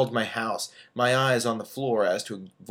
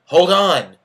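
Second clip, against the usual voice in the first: impatient; 7 semitones higher, 13 dB louder than usual. Acted. Tina impatient on the phone.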